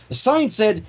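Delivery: ordinary delivery, nothing marked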